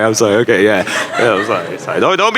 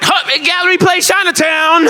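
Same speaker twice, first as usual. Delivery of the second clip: mock crazy passenger. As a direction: snap to attention at his stop. Crazy man noticing his stop.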